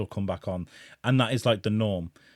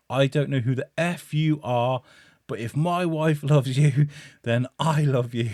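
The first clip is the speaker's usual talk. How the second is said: put-on louder, slow drunken declaration of love; hammed-up drunk bravado; 5 semitones higher, 4 dB louder than usual. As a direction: bellow it like a brave drunk hugging a stranger. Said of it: "I was like".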